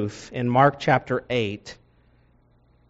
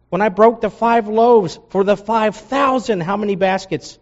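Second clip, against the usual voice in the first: Jesus scolding, high and pressing; frustrated Jesus; 9 semitones higher than usual. Acted. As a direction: press the disciples with holy exasperation. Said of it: "the sanctified frustration in Jesus' voice".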